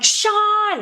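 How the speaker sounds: high, loud call to brother; excited holler of a name. Retold or imitated. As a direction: reenact yelling out big news. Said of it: "I was so excited".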